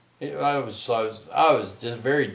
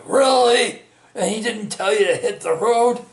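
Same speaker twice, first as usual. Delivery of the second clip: much higher and louder, asking in disbelief; incredulous question; 12 semitones higher, 8 dB louder than usual. Retold or imitated. Retelling how a stunned guy asked if he was sent away, high and loud disbelief.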